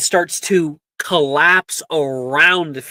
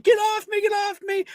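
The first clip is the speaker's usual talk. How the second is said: high, shrieked panicked plea; student screaming at the boils grabbing her. Acted. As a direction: scream it, terrified. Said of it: "She's just screaming".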